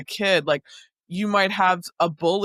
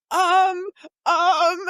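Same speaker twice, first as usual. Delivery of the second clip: stalling improviser; 13 semitones higher than usual. Retold or imitated. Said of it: "she has to kind of, like, improv".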